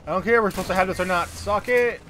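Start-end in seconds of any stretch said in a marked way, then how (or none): 1.3-2.0 gloating taunt. Real, not acted. Firing rocket at enemy.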